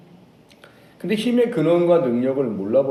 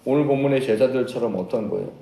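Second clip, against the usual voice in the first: ordinary delivery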